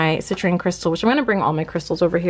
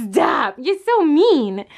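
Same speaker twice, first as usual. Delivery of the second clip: pet voice; 7 semitones higher, slower than usual. Real, not acted. Playful scolding of the dog.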